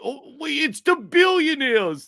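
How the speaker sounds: high mock Bernie backpedalling. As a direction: act out the convenient switch to billionaires. Mocking Bernie changing target.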